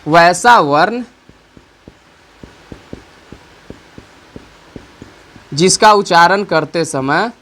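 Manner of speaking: slow, spaced dictation for writing down; teacher dictating slowly. Shown as a demonstration. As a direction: read it out slowly so they can write. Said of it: "इसको हम लिखते हैं".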